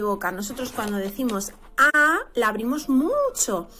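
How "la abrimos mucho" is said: usual speaking voice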